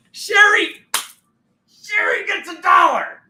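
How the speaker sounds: loud, high winner announcement; excited shout. Real, not acted. Host shouting out the quiz winner. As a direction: cheer her name out like a prize call.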